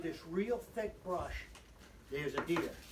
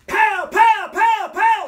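imitated gunfire, explosive and high; loud, high-pitched bang imitation; shouted gunshots; 12 semitones higher, 16 dB louder, slower than usual. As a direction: shout out each shot like a rifle crack. Imitates gunshots in hunting story.

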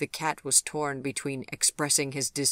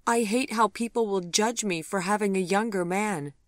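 higher, louder, worried; 7 semitones higher, 5 dB louder than usual. A narrator's reading. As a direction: voice the fear of being judged. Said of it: "hear the worry in her voice".

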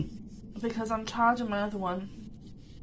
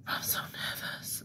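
slow nervous whisper; nervous excitement; 10 dB quieter than usual. Real, not acted. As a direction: whisper it, jittery. Nervous before going out.

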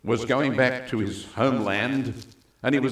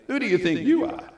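mock rebuke; 10 semitones higher, 4 dB louder than usual. God's imagined rebuke.

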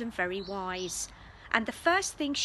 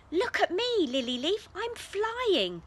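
high, loud delighted cry; excited call; 9 semitones higher, 3 dB louder than usual. A narrator's reading. Brother calling to the cat while flying, excited. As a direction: shout it with joy.

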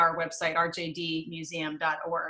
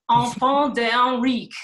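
slow, loud attempt at a French accent; 3 semitones higher, 9 dB louder, slower than usual. Shown as a demonstration. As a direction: put on a playful French accent, drawn out. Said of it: "say this with a French accent".

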